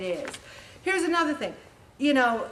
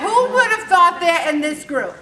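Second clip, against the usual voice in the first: louder, higher, with a laugh; laughing rhetorical jab; 7 semitones higher, 10 dB louder than usual. Real, not acted. Leader teasing her group: laughing and loud. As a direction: tease the room while laughing.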